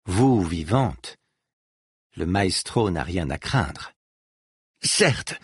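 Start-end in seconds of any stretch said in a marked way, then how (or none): 4.8-5.3 understated anger on a high-pitched word; furious. A narrator's reading. Dors answering her adversary, mildly furious. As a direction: toss it out high, with a touch of fury. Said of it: "dit Dors furieuse".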